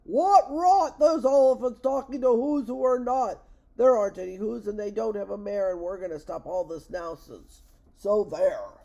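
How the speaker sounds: loud, high bullying shout, easing a little before the last word. A narrator's reading. Monkey brothers scold the elephant about Whos, shouting. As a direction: shout them down indignantly. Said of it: "came shouting".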